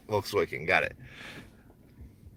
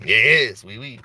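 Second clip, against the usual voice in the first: mock French accent; 4 dB louder, much slower than usual. Shop owner giving in to the role, mock French accent. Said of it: "play this role of some French man".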